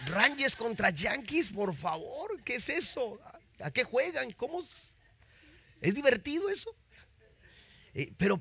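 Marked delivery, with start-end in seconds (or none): 0.1-6.8 high, laughing, incredulous "what is that?"; mock disbelief. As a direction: scoff at baseball with a laugh. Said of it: "Y yo dije". (incredulidad burlona, aguda y con risa)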